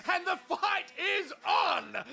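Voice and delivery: high, slow, laughing shout; excited fight call. Acted. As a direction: announce the brawl with glee. Commentator calling the fight.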